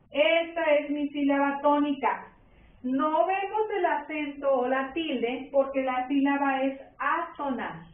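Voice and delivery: usual speaking voice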